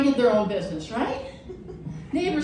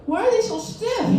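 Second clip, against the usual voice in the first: put-on puzzled kid; 7 semitones higher than usual. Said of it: "and I was like".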